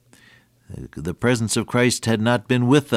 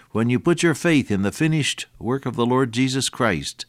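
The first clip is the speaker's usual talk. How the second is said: ordinary talk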